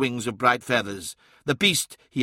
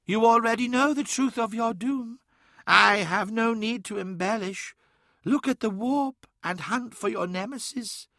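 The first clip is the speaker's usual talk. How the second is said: high, assured otherworldly voice; knowing daemon tempter; 8 semitones higher than usual. A narrator's reading. Mirror warns Magnus of his nemesis, high and knowing. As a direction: urge them on as an all-knowing daemon, pitched high.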